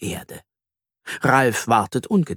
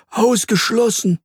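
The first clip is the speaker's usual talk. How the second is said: high, emphatic dismissal; firm contradiction; 11 semitones higher, 4 dB louder than usual. Acted. Earthworm contradicts Ralf's rabbit theory, emphatic. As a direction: rule it right out. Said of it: "widerspricht Herr Regenwurm".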